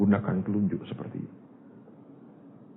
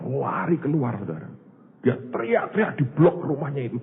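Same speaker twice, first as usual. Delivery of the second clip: normal talking voice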